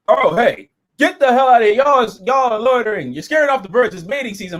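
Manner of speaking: a gruff-pitched park warden yelling at loiterers; hammed-up scolding warden; mock angry warden. S0 acts out Warden Dickhead scolding.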